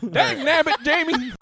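high, loud hillbilly outburst; Southern exclamation. Acted. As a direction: yelp it like an old Southern coot. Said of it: "so many Southern accents".

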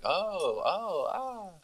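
high, breathy-free little gasps of surprise, imitated; mock startled gasp. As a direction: Colette caught off guard, gasping high. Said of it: "And then she's like".

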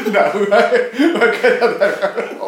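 laughing it off, loud and bright. Real, not acted. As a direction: burst out laughing through the words. Jokes about switching to immigration.

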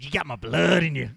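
put-on growing menace in a thick Southern accent; creepy Southern accent. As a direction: Louisiana witch doctor with a Southern twang. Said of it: "is a very different sentiment than".

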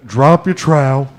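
slow, demanding customer voice; pushy customer voice, hint of anger; angry soccer mom voice. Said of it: "That was your angry soccer mom voice".

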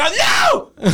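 high yelp of alarm; panicked shout. Acted. Doorman yelping as a fan gets in, high and panicked. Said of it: "We just like".

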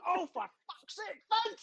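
high, drawn-out mock despair; exasperated dog owner. Man in park after runaway dog, imitated exasperation. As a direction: imitate an owner losing control of his dog. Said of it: "he's shouting at his dog".

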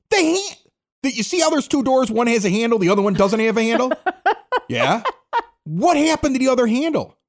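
high, pressing interrogation; exasperated dad. Retold or imitated. Father grilling his son about the missing handle, high and exasperated. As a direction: build the case like a fed-up father.

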